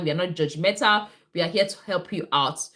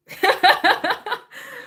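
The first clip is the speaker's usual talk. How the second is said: high laugh; 13 semitones higher, 4 dB louder than usual. Real, not acted. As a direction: burst of high laughter at the mishap. Laughing at her own spill.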